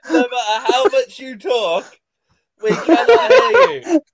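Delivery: loud, high laughing call to a muted friend; laughing shout. Real, not acted. Friends shouting at Carl whose mic is muted, laughing loud. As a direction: yell it through laughter so he hears.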